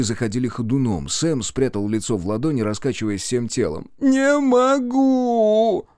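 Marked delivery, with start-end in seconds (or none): 4.0-5.9 high, very drawn-out cry of despair; despairing wail. A narrator's reading. Sam collapses in grief for his sister, despairing wail. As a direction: wail it slowly, louder and higher.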